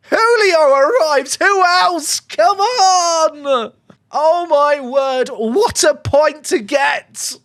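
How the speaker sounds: high, drawn-out jubilant cries; triumphant goal celebration. Real, not acted. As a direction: roar the hero's arrival. Celebrating the late equalising goal.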